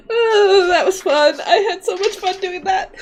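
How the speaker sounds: giddy, laughing through words. Real, not acted. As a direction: still laughing, high and bright. GM laughing after the scene.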